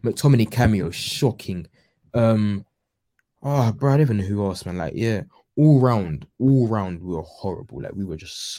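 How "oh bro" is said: ordinary talk, nothing marked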